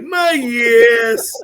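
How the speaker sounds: high shouted "My yes" with a laugh; Southern accent. Retold or imitated. Teasing a friend about his Southern accent, high and laughing. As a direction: yell it in a hammy Southern accent. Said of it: "the accent ever gets that deep".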